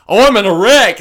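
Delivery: loud high mock-panicked prank call; fake distress call. Friend imagines a prank call to the girlfriend, mock panic. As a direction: ham it up like a fake emergency.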